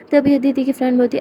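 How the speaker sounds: usual speaking voice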